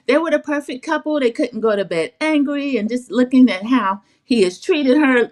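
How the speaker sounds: lightly voiced Miss Diane. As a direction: lightly mimic her, lifting the pitch. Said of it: "Miss Diane says".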